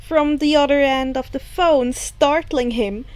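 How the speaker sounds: usual speaking voice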